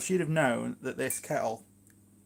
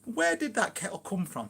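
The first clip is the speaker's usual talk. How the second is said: lightly imitated partner, pitched higher; higher voice for a quoted partner's question; imitating other half; 8 semitones higher than usual. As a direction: ask it as your other half, voice raised in pitch. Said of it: "she goes".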